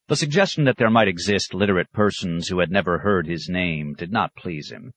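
ordinary delivery, nothing marked

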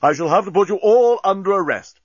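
loud, high outraged order; stern captain. A narrator's reading. Stern captain announces an arrest.

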